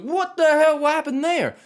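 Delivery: exasperated high outburst at the scores; incredulous outburst. Real, not acted. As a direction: throw up your hands in disbelief. Mocking critics' scores.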